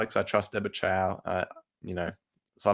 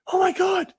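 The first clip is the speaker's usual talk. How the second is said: very high, loud falsetto squeal; mock fan hype; 5 dB louder, slower than usual. Mocking fans who obsess over cameos, hammed-up hype. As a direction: squeal it like an overexcited fan, drawn out.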